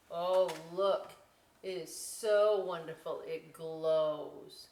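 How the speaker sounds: put-on lively read-aloud voice for children; storybook reading. A narrator's reading. Teacher reading a picture book to children, lively storybook voice.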